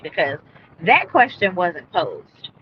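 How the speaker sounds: usual speaking voice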